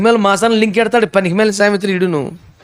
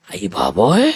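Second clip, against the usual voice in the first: much lower put-on disciples' voice; exasperated exclaim; 12 semitones lower, slower than usual. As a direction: groan it in mock exasperation.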